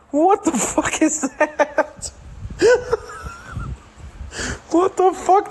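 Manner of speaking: laughing disbelief. Real, not acted. Shocked laugh at screen.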